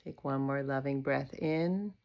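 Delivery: unhurried yoga nidra voice with long pauses; guided meditation. Acted. Yoga teacher leading a body scan for sleep, guided meditation. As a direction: guide attention gently through the body, leaving space after each phrase.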